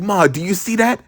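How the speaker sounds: imitated excited kid to mom; urgent asking. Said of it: "I was like".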